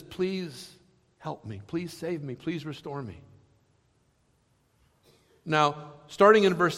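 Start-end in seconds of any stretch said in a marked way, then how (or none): 0.0-3.2 soft, hushed begging; quiet plea. Retold or imitated. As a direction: plead softly for rescue. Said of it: "And he says".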